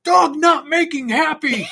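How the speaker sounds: high, loud broken-English joke voice; mock caveman talk. Joking about the barking dogs next door, loud caveman voice. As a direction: speak like a simple-minded caveman. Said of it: "I'm thinking to myself, like".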